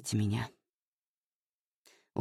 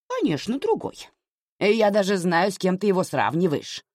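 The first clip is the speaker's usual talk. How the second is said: knowing, mocking snort; scoffing; 7 semitones higher than usual. Mother scoffs at Anya, knowing and mocking. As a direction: needle her with a smirk. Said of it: "хмыкнула мать".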